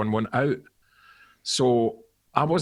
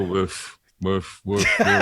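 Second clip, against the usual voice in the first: lower, sulky woofs; grumpy backing vocals; 5 semitones lower, much slower than usual. Retold or imitated. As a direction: bark out the backing vocals like you hate it. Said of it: "but really grumpily".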